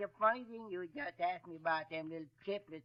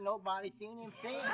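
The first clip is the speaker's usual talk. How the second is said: usual speaking voice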